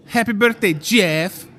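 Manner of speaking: lightly sung-out birthday greeting; a light, high birthday call; cake writing request. Retold or imitated. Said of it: "take it to the bakery and say".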